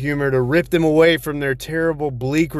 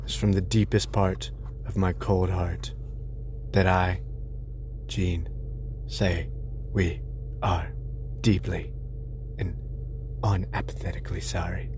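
mock solemn apology; 7 semitones lower, 8 dB quieter than usual. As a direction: hush it like a grave confession.

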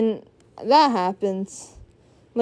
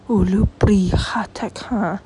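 whispered nonsense words. Pretend. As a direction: breathe the gibberish in a mock-secret whisper. Whispered gibberish after accent bit.

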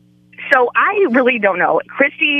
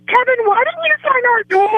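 higher, excited playful protest; retold high complaint; 13 semitones higher than usual. Fans calling out to Kevin about the door, high and excited. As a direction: call him out, pitched high. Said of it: "you hear us go".

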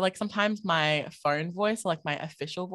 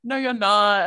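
louder, higher dismissive retort; mock contradiction; 5 semitones higher, 8 dB louder, slower than usual. Guest mimics people doubting her origin, mock contradicting. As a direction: contradict flatly in a raised voice.

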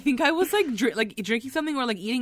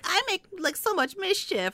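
mock impish Nan; 9 semitones higher, 4 dB quieter than usual. Voicing Nan's carefree glee.

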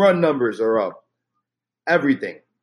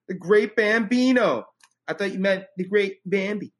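Sandlot movie quote; 6 semitones higher than usual. Retold or imitated. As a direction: quote the kid from the film. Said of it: "Sorry, I had to quote The Sandlot".

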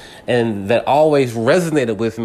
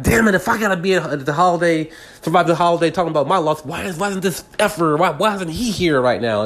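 higher-pitched furious inner rant; 7 semitones higher than usual. Retold or imitated. Fuming about the absent father, higher furious rant. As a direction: vent it fast and high, fed up. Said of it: "It just made me just furious".

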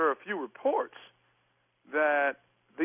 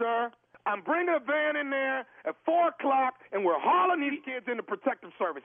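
higher, insistent official warning; stern ultimatum; 8 semitones higher than usual. Real, not acted. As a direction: announce the deadline in a raised pitch. Caller lays down ultimatum in quarrel.

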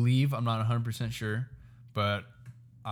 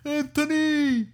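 high, drawn-out dad yell; hammed-up, stretched-out high call; TikTok dad impression; 4 dB louder, slower than usual. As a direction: imitate a dad hollering for his kid. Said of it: "About the Italian dad".

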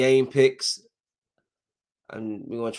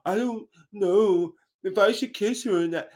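a hint of a whiny, timid guy, pitched higher; mocking an unsure guy; 8 semitones higher than usual. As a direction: mimic the nervous TV boyfriend. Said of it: "these guys that don't know how to talk to women".

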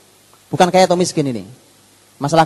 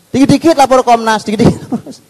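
higher, louder quoting of the minister's warning; emphatic quote; 9 semitones higher, 6 dB louder than usual. Retold or imitated. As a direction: drive the phrase home like a slogan. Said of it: "Itu kalimat beliau".

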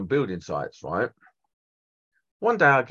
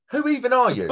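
incredulous; 10 semitones higher than usual. Retold or imitated. Retold confrontation with wife.

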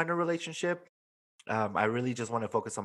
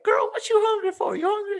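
squeaky, teasing high voice; pitched way up to tease a hungry coworker; high playful girlfriend voice; 6 dB louder than usual. Retold or imitated. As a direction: ask it like a sassy friend teasing. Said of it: "and I was like".

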